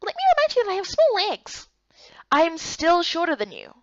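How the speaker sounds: high, light little-creature voice; small companion voice. Acted. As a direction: play the tiny friend, perky.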